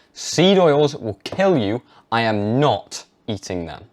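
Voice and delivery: slow, firm and certain; with conviction. Shown as a demonstration. Showing a teen how to tell his parents, slow and certain. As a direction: state it flat out as fact. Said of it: "Can you see the difference?".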